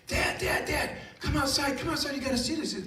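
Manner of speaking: put-on high, urgent little-kid voice; imitating an overexcited small child; excited six-year-old. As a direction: burst in begging Dad to come look. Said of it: "six-year-old son came running in and said".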